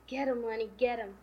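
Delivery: loud, punchy retold urging. George urging Lenny on in fight.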